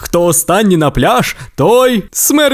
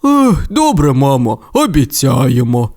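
зітхає Робін. Acted. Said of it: "зітхнув Робін".

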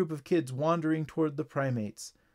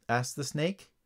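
usual speaking voice

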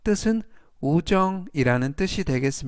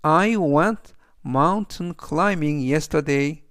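every word spoken slowly and clearly; slow model reading; much slower than usual. Shown as a demonstration. Teacher reading the example sentence to students, slow and clear. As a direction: read the sentence slowly for learners. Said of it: "그래서 전체적 의미는".

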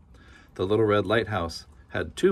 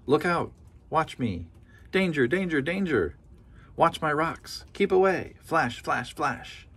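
higher, urgent lighthouse warning; urgent warning call; 7 semitones higher than usual. A narrator's reading. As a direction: warn the boats away from the rocks. Lighthouse warns boats of rocks.

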